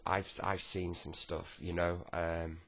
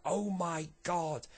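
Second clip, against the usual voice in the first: shocked; 9 semitones higher, much slower than usual. Retold or imitated. Kid reacts to heart attack.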